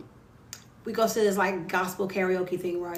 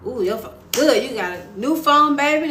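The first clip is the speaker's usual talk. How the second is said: excited cheer; 4 semitones higher, 7 dB louder than usual. Real, not acted. Cheering a viewer's new phone.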